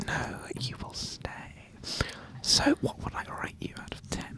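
hushed whispered test; ASMR whisper. Pretend. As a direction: whisper close to the mic. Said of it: "let's do some A S M R".

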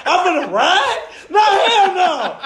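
laughing mock shout. Rapper refusing his label, laughing mock shout. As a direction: scoff it out, half yelling and laughing.

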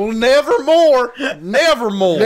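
loud annoying Poe. Acted. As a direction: announce yourself like an obnoxious bro. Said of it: "so loud and annoying".

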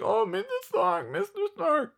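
very high, whimpering mock plea; badly faked tearful boy voice; Peter. Fan mocking Peter's grief over Stark, high whimper. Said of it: "like, Peter's like".

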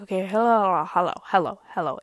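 loud, repeated hellos like waving him down; calling out hello. Retold or imitated. Owning up to the coach, a loud mock call of hello. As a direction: call it out so he can't miss you.

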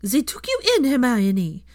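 high, loud accusing voice; outraged family reproach; angry reproach. A narrator's reading. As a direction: lash out in disgust. Accusation continues raised.

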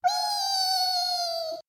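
very high squeaky cheer; tiny barnacle squeal. Acted. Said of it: "all cried out".

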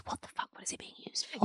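hushed whispered aside. Real, not acted. Whispering her curiosity to her co-host. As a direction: drop to a whisper for the naughty question.

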